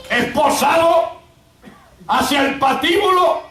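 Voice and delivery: preacher building the drama; full-force preaching. Acted. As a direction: declaim phrase by phrase, pausing between each.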